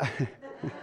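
short soft chuckle at his own joke; self-mocking laugh. Real, not acted. Preacher laughing at his own bald joke, a brief chuckle. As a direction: laugh at yourself, breathy and quick. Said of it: "I lo".

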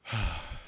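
a soft, low sigh; weary sigh. Retold or imitated. Pastor voicing Jesus with his disciples, a soft sigh. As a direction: sigh like a patient teacher. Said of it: "He's just".

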